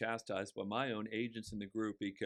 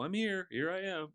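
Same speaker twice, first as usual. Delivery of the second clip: much higher, cheery here-I-am; cheerful announcement; 9 semitones higher than usual. Retold or imitated. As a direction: pop up brightly like a surprise arrival. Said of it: "I go".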